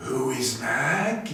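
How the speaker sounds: slow, menacing snake voice; cobra Nag. A narrator's reading. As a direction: let each word coil out slowly. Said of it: "said he".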